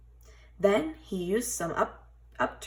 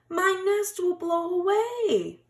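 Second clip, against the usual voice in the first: very high, slow, louder bird voice; flustered bird; 13 semitones higher, 5 dB louder than usual. A narrator's reading. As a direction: wail it like a panicking little bird. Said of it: "flapped Bird".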